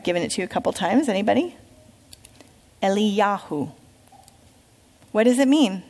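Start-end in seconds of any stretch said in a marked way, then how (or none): 2.8-3.7 Eliyahu, said el-ee-YAH-hoo; Hebrew pronunciation. Shown as a demonstration. Teacher saying Eliyahu to the class, el-ee-YAH-hoo. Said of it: "how you say Elijah's name in Hebrew".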